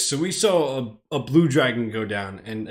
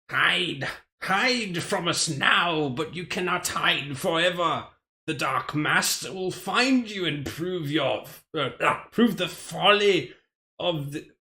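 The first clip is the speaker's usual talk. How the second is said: slow, higher menacing villain voice in a put-on accent; 5 semitones higher, slower than usual. Streamer voicing the beasts' threat, slow put-on villain accent. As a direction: taunt the hidden egg like a gloating beast. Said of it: "why am I going in accent?".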